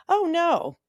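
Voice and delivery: high, loud forced brightness; mock breezy no; fake polite denial. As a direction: chirp it like an obvious lie. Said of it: "I lied".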